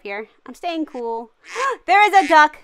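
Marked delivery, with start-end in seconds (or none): 1.6-2.6 sudden loud gasp of surprise; startled, cry it out; shocked gasp. Real, not acted. Duck appears, gasps.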